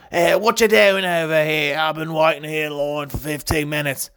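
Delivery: mean customer moaning in a mock Australian accent. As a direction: complain in a put-on Australian accent. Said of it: "I go to Australian accent for my mean customers".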